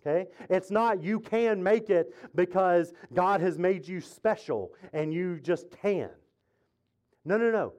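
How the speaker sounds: ordinary talk, nothing marked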